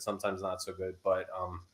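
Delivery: usual speaking voice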